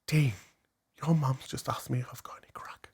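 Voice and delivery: hushed, shocked whisper. Retold or imitated. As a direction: whisper it in disbelief. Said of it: "she was like".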